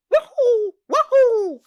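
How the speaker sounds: loud high Mario yelp; Mario "Wahoo". Retold or imitated. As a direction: squeal it like a jumping plumber. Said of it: "and then the".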